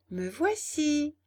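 high, slow piping reply; tiny unseen creature announcing itself; little voice. Acted. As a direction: sing out small and high from hiding. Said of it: "vers la petite voix".

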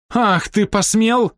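high, slow outrage; outraged gasped exclamation. A narrator's reading. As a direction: exclaim in shocked fury. Фрэнсис отшатнулась от двери. (возмущённый возглас)

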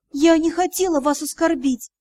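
normal talking voice

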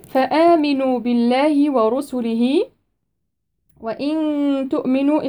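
slow measured tajweed recitation; Quran recitation. Shown as a demonstration. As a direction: recite it with tajweed, stretching the vowels.

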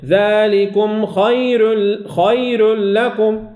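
ذلكم خير لكم chanted slowly, the ra of خير thin; Quranic recitation. Shown as a demonstration. Teacher recites verse to show light ra. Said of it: "لا نقول خير".

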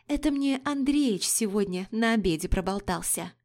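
usual speaking voice

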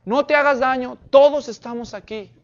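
loud, high urgent call; acted shout. Paul stopping the jailer from harming himself, loud acted shout. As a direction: open with a big hailing yell, then keep it loud. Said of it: "Pablo le grita y le dice".

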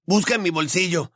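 put-on high, pressing boy's voice; urging. A narrator's reading. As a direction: press a friend to act at once. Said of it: "urgió Ron".

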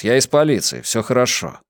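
ordinary delivery

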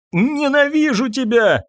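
high, drawn-out cry of hatred; hateful outburst. A narrator's reading. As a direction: lash out, stretching the words at a high pitch. Mother attacks the man she blames.

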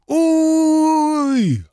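sharp yelp of pain; high pained yelp. Acted. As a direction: yelp as the blow lands. Fighter hit.

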